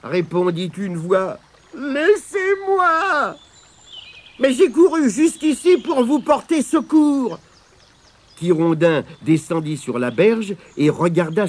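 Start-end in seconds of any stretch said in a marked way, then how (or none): 1.7-3.3 high, very drawn-out wail; wailing plea. A narrator's reading. Weeping stranger refusing company, wailing. 4.4-7.4 high-pitched, insistent offer of help; eager protest. A narrator's reading. Tirondin insisting to the stranger, high protest.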